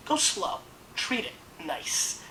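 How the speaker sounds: hushed advice. Real, not acted. Advising a gentle slow pour.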